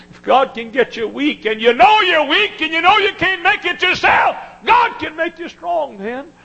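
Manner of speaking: high, loud shouting climax; preacher shouting the promise to the weak; preaching shout. Acted. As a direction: hit the climax at full voice.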